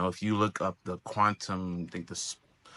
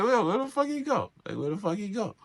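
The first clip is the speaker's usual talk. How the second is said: mock confusion; 11 semitones higher than usual. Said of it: "You're like".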